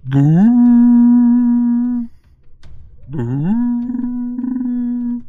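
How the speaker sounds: very loud, high booing shout; jeering boo. Acted. Lizard in the tower jeers at the arrivals with a loud boo.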